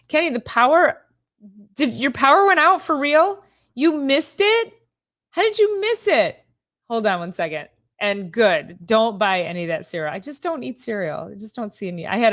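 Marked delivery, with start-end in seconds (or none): 0.1-6.4 much higher, louder, rising in disbelief; can't believe her friend missed it; surprised disbelief. Real, not acted. Asking Kenny how he missed it, astonished.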